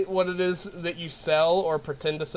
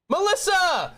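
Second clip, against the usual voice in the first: high, loud excited name call; 14 semitones higher, 4 dB louder, slower than usual. Real, not acted. Streamer spotting a viewer in chat, high excited call. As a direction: call out her name with delight.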